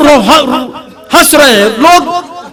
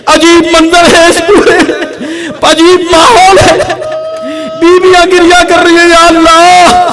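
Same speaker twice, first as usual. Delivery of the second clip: impassioned lament; 5 semitones higher than usual. Acted. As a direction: pour grief into every line. Mourning climax of the majlis.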